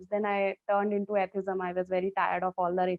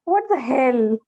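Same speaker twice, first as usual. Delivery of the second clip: louder, disbelieving retort; incredulous outburst; 3 semitones higher, 8 dB louder, slower than usual. Retold or imitated. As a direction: fire it back in disbelief. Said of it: "I'm like".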